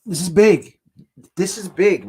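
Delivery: loud, hyped declaration; excited. Real, not acted. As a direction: announce the big moment loudly. Big draft moment arriving.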